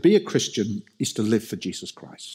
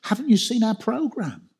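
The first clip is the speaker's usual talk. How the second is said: higher, indignant mock reply; mock church protest; 11 semitones higher than usual. Imagined church members protesting to the Lord, higher and indignant. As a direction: whine back like a proud busy church. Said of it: "you can imagine them saying".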